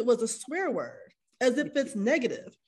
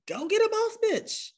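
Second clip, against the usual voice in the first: mock high voice; 10 semitones higher than usual. Mocking a man's preferences.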